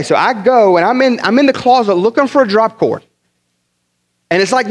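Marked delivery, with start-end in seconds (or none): none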